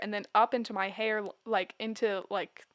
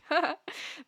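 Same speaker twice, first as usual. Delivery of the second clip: short, higher-pitched mock laugh; re-enacted laugh; 6 semitones higher than usual. Patient told by doctor of tumor, re-enacted laugh. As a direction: act out a quick incredulous laugh.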